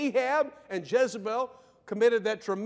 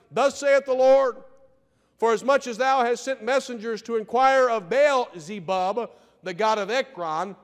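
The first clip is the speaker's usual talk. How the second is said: prophetic declaration; 4 dB louder than usual. Acted. Preaching prophecy at full force.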